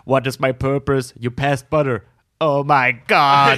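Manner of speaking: slow, dismayed robot voice; a little robot realizing its sad fate; rusty robot. Retold or imitated. Butter robot quoted from show.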